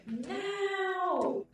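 "now" held very long, high and loud; emphatic NOW. Retold or imitated. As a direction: cry out "now" as one long, ringing call. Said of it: "I heard an emphasis on the word now".

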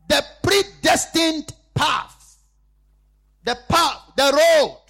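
loud, slow, high sermon cry; full-force preaching. Acted. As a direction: drive each word into the congregation.

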